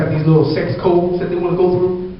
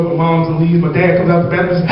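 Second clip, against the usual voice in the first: usual speaking voice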